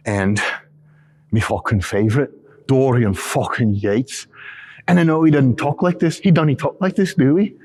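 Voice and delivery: high, slow, hammed-up British Midlands accent; mock Midlands accent. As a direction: ham up a Midlands-Scottish-Irish mash of an accent. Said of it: "some kind of fucking Midlands accent".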